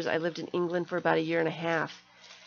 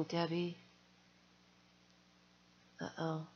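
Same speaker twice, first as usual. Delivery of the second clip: ordinary delivery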